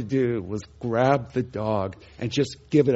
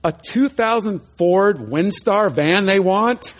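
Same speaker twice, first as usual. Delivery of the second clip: disbelief; 6 semitones higher, slower than usual. Retold or imitated. Disbelief at the lady's claim.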